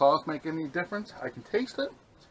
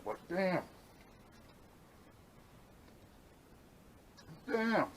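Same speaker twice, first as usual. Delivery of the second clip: high, chewing, mouth full; 7 semitones higher, 9 dB quieter, slower than usual. Real, not acted. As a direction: say it through the food. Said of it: "talk with my mouth full".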